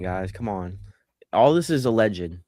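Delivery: ordinary talk, nothing marked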